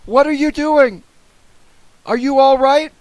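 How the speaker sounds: very high, loud shouted questions; worried yell. A narrator's reading. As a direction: shout down anxiously to check on them. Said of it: "I yelled".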